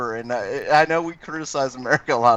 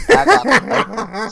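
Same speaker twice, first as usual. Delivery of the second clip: louder, much higher Southern twang; Ohio Southern accent; 11 semitones higher, 5 dB louder than usual. Shown as a demonstration. Co-host offering another regional take, broader Ohio Southern accent. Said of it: "you want Ohio Southern?".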